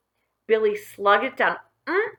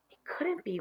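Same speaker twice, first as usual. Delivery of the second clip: much softer, hushed disbelief; whisper; 7 semitones higher, 10 dB quieter than usual. Acted. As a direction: breathe it in shock. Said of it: "whispered Tom".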